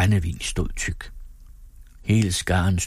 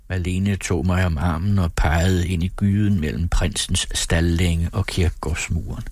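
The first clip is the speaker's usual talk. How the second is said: ordinary delivery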